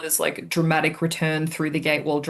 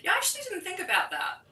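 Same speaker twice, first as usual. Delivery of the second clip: distant, thin voice away from the microphone; far from the mic; 13 semitones higher, 4 dB quieter, faster than usual. Real, not acted. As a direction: sounds off-mic. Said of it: "[name], you sound so far away".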